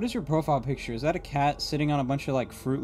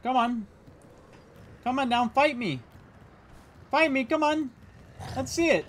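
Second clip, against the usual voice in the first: lighter high alien voice, cocky and taunting; alien goading; 10 semitones higher than usual. Acted. Daring the enemy to come down, lightly voiced cocky alien. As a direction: egg them on to fight, in a hint of the alien voice.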